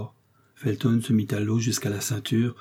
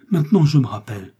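raised, louder voice of sudden recall; exclaiming; 4 semitones higher, 6 dB louder than usual. A narrator's reading. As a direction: exclaim as the memory comes back. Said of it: "s'écria Milady".

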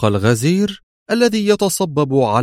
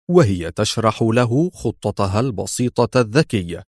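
ordinary delivery

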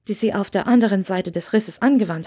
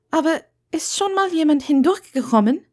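put-on higher, hesitant young witch; Hermine, hesitant; 8 semitones higher than usual. A narrator's reading. Hermine introduces herself to Wendeline, high and unsure. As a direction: stumble a little, then give your name.

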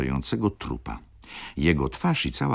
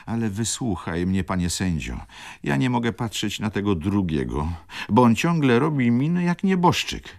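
pleading baroness, a touch of tears, pitched up; tearful plea; 8 semitones higher than usual. A narrator's reading. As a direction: plead as if close to tears, voice rising. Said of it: "zakończyła ze łzami". (błagalnie, z lekkim płaczem)

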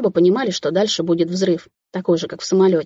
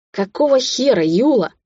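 irritated demand; 6 semitones higher than usual. A narrator's reading. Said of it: "как на занозу в заднице".

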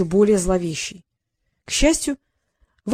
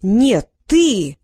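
loud indignant retort; boy throws the accusation back, loud and indignant; 5 dB louder than usual. A narrator's reading. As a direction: snap it back at him, outraged. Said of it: "возмутился Дерик".

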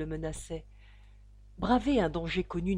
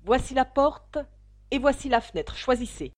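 loud, higher, threatening; raising his voice; 6 semitones higher, 8 dB louder than usual. A narrator's reading. Father shows suitors the door, loudly. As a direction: order them out. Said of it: "continua Ferrier en élevant la voix".

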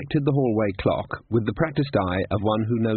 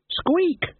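rat squeak. Acted. Death of Rats squeaks.

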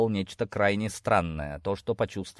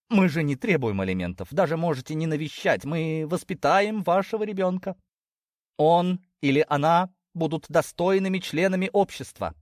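higher-pitched, earnest promise; pleading mother; 7 semitones higher than usual. Acted. Mother promising his lordship to raise the child, pleading. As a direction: beg persuasively, pitch raised.

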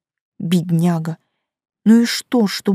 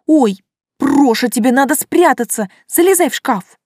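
panicked; 5 semitones higher, 4 dB louder than usual. Acted. Panicked urging to hide.

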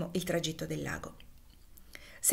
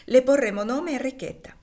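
much higher and louder, merry; playful delight; 6 semitones higher, 7 dB louder than usual. Acted. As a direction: announce it with glee. Mother playfully names child. (la madre scherza sul nuovo nome, gioiosa e acuta)

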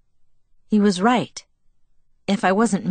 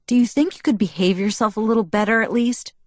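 higher, exasperated ask; irritated; 4 semitones higher, faster than usual. Acted. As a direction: scold him, fed up. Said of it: "I asked, irritated".

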